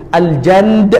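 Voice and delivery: Malay "Janda" dressed up as Arabic "Al-Jand"; playful fake-Arabic flourish on "Al-Jand"; mock Arabic.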